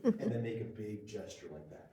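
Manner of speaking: ordinary delivery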